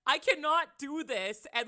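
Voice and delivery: exasperated inner protest. Retold or imitated.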